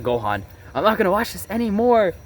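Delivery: high, strained, fed-up retort; angry outburst. Retold or imitated. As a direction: snap back, fed up. Retold confronting drunk mom.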